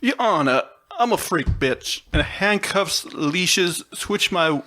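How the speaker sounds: put-on Southern courtroom drawl; mock Southern lawyer addressing the bench. Lyric says Your Honor, so mock Southern lawyer. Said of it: "like, a Southern lawyer".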